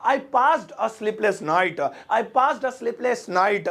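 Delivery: "I passed a sleepless night" said very slowly; slow example sentence. Shown as a demonstration. Teacher models the example sentence very slowly. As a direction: lay it out slowly for learners. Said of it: "जैसे मैं कहना है".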